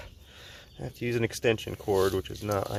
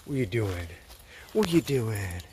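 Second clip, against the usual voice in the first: talking to a dog; 4 dB quieter than usual. Real, not acted. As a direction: coo at the puppy.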